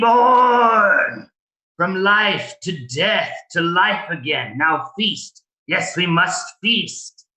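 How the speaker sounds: high, slow, otherworldly cultist chant; gravelly voice. Acted. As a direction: intone it like a fanatic ghoul. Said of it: "a gravelly voice say".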